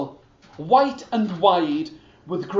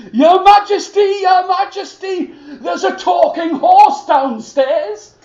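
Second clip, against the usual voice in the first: high, loud, breathless shouting to wake him; urgent shouting; 11 semitones higher, 10 dB louder than usual. Acted. The soldier shouts at the sleeping king about the horse. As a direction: yell him awake.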